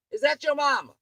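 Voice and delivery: ordinary talk, nothing marked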